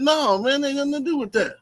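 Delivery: high, insistent denial; high denial he's brushing off; high protest. Retold or imitated. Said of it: "I said".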